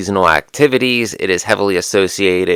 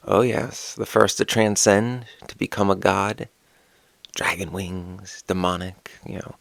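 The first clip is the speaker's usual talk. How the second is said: hushed awe; 8 dB quieter than usual. Acted. Telling viewers of Lilith's transcendence, softly hushed and awed. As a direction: lean in and drop the voice in reverence.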